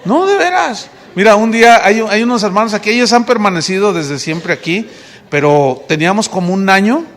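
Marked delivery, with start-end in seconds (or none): 0.0-0.9 riendo al decirlo, más agudo; laughing. Real, not acted. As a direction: se ríe de los que quieren predicar. Pastor mocks eager new preachers. (entre risas)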